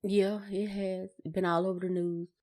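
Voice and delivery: ordinary delivery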